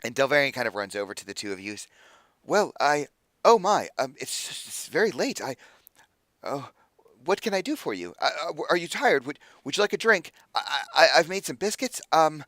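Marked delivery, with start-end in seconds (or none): 2.4-12.4 higher, halting stammer; anxious-to-please host, pitched up; flustered host. Acted. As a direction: fuss over unexpected guests, tripping on words. Flustered host greets late visitors.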